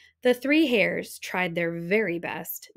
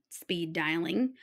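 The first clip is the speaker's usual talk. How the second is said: ordinary delivery, nothing marked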